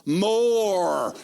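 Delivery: mimicked one-word command, held long; imitating the minister.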